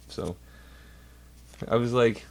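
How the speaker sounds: usual speaking voice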